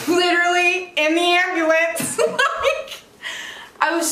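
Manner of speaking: high voice breaking up with laughter; laughing. Real, not acted. Woman recalling texting from the ambulance, laughing. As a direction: laugh through the words in real disbelief. Laughs at her own absurd story.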